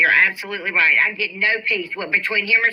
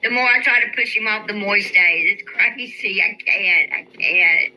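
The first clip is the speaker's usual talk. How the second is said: ordinary talk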